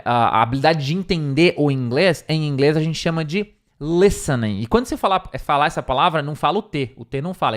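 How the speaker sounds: ordinary delivery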